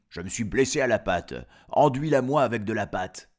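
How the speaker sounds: loud, insistent acted request; demanding wolf. Wolf to the baker about his paw, demanding. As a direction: order the baker about with mock urgency.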